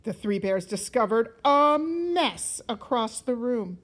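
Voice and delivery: usual speaking voice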